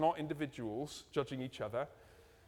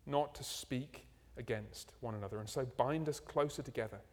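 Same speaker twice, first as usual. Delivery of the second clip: closing prayer; 4 semitones lower than usual. Acted. Pastor praying for the church after the sermon, reverent.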